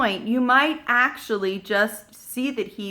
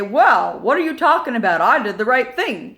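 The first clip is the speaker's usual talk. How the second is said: louder, quick defensive comeback; defensive teen; 9 dB louder than usual. Retold or imitated. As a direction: push back like a cornered teenager. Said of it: "they're gonna get self-defensive".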